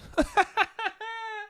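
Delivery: short high giggle; high laugh. Real, not acted. Podcaster giggling after a cheeky line, high laugh. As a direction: giggle at your own cheek.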